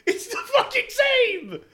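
very high, slow laughing protest; laughing, exasperated squeal that they match. Real, not acted.

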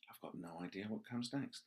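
softly, a dawning blank; hushed panic. Retold or imitated. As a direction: whisper the dread of forgetting. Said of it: "I just thought".

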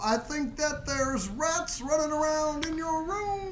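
Cosby. Shown as a demonstration. Co-host giving a baseline for the accent attempt, slow high Cosby. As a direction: draw every phrase out in a high impersonation. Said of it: "baseline of what Cosby would do".

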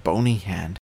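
ordinary delivery, nothing marked

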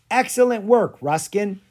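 high, loud and delighted; bright, loud praise; praising. A narrator's reading. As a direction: cheer him on. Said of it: "praised Lucas".

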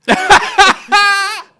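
big high laugh, almost crying; loud burst of laughter. Real, not acted. Laughing hard while telling friend about Umbanda. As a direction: laugh hard at your own story.

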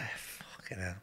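breathy whispered swear; whispered curse. Retold or imitated. Muttered reaction, retold.

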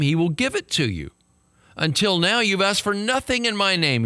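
scripture read with mounting fervor; rising preaching. Acted. Pastor to congregation, reading Jesus' words with rising fervor.

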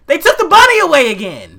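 high, loud outraged complaint; indignant rant. Real, not acted. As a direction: demand it in exasperated outrage. Ranting about the missing bunny.

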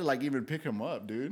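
normal talking voice

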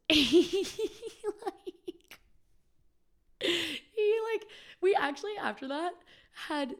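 words broken by high laughter; real laughing; laughing while talking. Real, not acted. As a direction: talk through the giggles.

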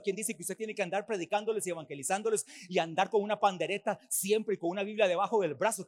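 usual speaking voice

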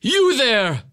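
a hint of a stern scolding gentleman; stern timekeeper. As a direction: scold the street kid sternly. Timekeeper scolds Artful Dodger.

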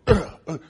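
high loud pained grunts; imitated grunts. Men getting hit in the paintball face-off, loud grunts. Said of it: "And guys are going".

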